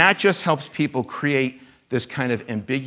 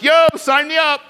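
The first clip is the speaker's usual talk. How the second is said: higher, louder, eager shout; hyped-up yo, sign me up; eager convert; 8 dB louder than usual. Retold or imitated. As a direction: jump at the altar call. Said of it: "I'd be like".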